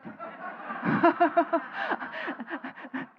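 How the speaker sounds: long breathy laugh at his own punchline. Real, not acted. Speaker laughing at the joke he just told, real laugh. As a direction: laugh it off, half silent.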